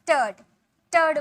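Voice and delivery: टर्ड as "tuhd", with no r; silent r. Shown as a demonstration. Teacher shows learners the ending, r left silent. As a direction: isolate टर्ड, dropping the r. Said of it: "यहाँ पर आर का sound नहीं निकालेंगे".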